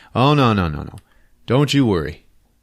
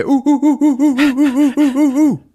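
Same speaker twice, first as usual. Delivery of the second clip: loud, very high ape hooting chant; gorilla hoots; 5 dB louder than usual. Retold or imitated. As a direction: drown him out with monkey hoots. Warriors hoot him down again.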